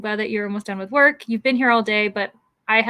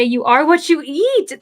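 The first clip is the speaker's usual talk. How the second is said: slow, louder, higher saying; a quoted old saying, said with weight; mock proverb voice; 5 semitones higher, 6 dB louder than usual. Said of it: "they do say".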